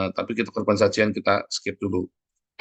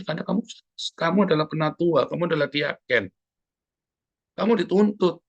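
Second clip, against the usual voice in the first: fervent preaching; 6 semitones higher than usual. Acted. Pastor exhorting church leaders to accept sacrifice, fervent preaching. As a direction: preach it with rising fervour.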